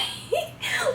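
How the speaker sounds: high squeal. Real, not acted.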